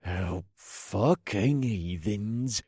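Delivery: slow growling curse; growled. Acted. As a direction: growl it out slowly with contempt. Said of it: "a soldier growled".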